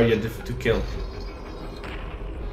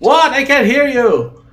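loud exaggerated yell; shouting; 12 semitones higher, 8 dB louder, slower than usual. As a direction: bellow so the library hears. Shouting in library.

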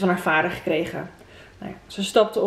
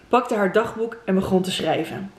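ordinary delivery, nothing marked

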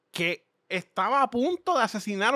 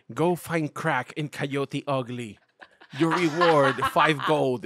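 slow, lightly voiced NPC quest giver; 2 semitones lower, much slower than usual. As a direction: hand out the quest like a video game NPC, slowly. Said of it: "El quest giver". (voz lenta de personaje de videojuego que da misiones)

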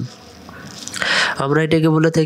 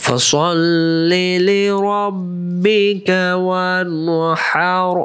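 full melodic Quran recitation; 2 semitones higher, much slower than usual. Shown as a demonstration. Teacher reciting the whole surah from the start. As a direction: sing each phrase out slowly and melodically. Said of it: "শুরু থেকে".

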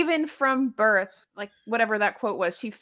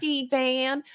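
put-on Southern accent; 4 semitones higher than usual. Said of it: "American Southern people were going".

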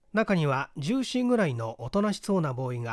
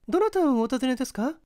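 put-on high, polite young housemaid; young maid voice; 10 semitones higher, 3 dB louder, faster than usual. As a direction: lift into a bright young servant girl.

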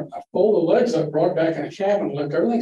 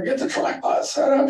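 mock yell; 10 semitones higher than usual. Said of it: "he's yelling at her".

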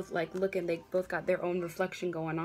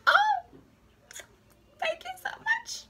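very high, overwhelmed mock thank-you; gushing thanks; 4 dB louder than usual. A YouTuber thanking new subscribers, gushing very high. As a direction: squeal your thanks like a touched fan. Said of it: "I was like".

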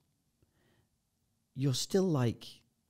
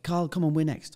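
raised, higher call over the roar; shouting over wind; 6 semitones higher, 4 dB louder than usual. Retold or imitated. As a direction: yell it over the open plane door. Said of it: "'cause obviously you gotta shout, it's so loud".

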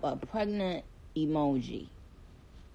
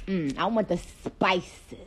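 ordinary talk, nothing marked

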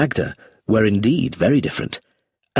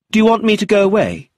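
high, loud, quick and cocky; slightly arrogant; 11 semitones higher, 6 dB louder, faster than usual. Acted. Teenage boy to the inspector, pleasant but slightly arrogant. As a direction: toss the question off with a touch of arrogance. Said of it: "pleasant, but slightly arrogant".

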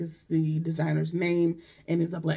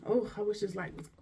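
ordinary talk

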